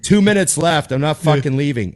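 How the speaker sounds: comic telling the crowd he stays, defiant; defiant taunt. Retold or imitated. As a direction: refuse to leave the stage, loudly. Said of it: "He's like".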